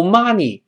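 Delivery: "money" with an open ah, like 妈; wrong-example "money", mouth too wide on the first vowel; mispronounced "money". Shown as a demonstration. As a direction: say "money" as "mah-nee". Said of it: "不要读money".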